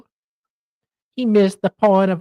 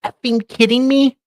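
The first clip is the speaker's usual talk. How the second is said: loud, high, rapid disbelief; incredulous rant; 4 semitones higher, 4 dB louder, faster than usual. Real, not acted. Cohost ranting at a New Zealand viewer, loud disbelief. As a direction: hammer each not only.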